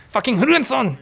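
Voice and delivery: high-pitched mock cursing in English and German; imagined player swearing. Commentator imagines the losing player swearing, mock-angry and high. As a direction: curse as the frustrated player. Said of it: "Pretty sure he's like".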